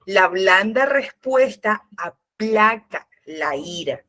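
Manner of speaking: slow, stretched reading of the verse; slow emphatic quote. Shown as a demonstration. Preacher reciting Proverbs slowly for emphasis. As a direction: draw out respuesta, lay each word down. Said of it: "en Proverbio me dice".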